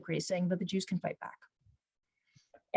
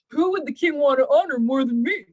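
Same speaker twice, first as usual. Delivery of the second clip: raised, louder self-satisfied inner thought; self-important Haman; 3 semitones higher, 5 dB louder than usual. Retold or imitated. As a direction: think it proudly, sure the honor is yours. Haman acted, thinking of himself.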